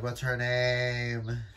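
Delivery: ordinary talk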